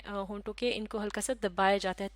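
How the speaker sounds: usual speaking voice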